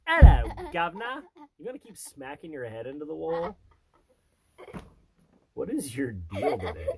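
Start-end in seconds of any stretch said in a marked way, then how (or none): 0.1-1.2 loud, high hammed-up Cockney hello; over-the-top British hello; mock Cockney greeting. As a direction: shout it like a cartoon Londoner. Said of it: "I am British".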